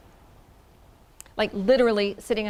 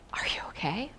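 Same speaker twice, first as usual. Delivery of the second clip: hushed worried question; concerned whisper; 7 dB quieter than usual. Retold or imitated. Julie checking on her friend, whispered concern. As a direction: ask softly, not to startle her.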